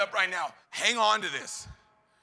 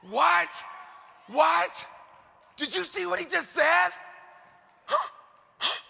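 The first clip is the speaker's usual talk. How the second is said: amazed shout; 9 semitones higher, slower than usual. Real, not acted. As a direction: shout it like you can't believe it. Preacher amazed by the quote.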